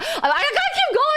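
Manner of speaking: high, quick, laughing self-push; laughing panic. Retold or imitated. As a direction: urge yourself on, half laughing. Quoting herself mid-run.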